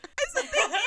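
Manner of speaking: high, loud little squeak. Retold or imitated. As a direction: squeeze the words out, starstruck and high. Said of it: "all I could squeak out was".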